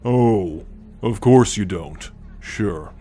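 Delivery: ordinary talk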